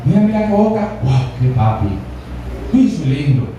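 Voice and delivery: a hint of a smug prince at his mirror; vain prince admiring himself. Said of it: "se miraba decía". (príncipe vanidoso admirándose, voz algo más grave)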